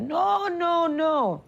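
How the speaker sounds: high, firm no, no, no; mother's flat refusal. Retold or imitated. Daughter imitating her mother refusing her politics plan, high and firm. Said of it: "She said".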